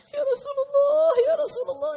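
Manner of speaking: loud, high acted wail; trembling weeping. Man overcome with longing for the Prophet, weeping wail. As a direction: cry out high as if sobbing and trembling.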